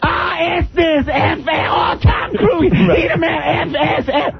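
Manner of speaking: mock her raging at the screen, squealing high; shrill mock rant. Comedian mimicking the woman yelling at the movie, high mock shout. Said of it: "she was like".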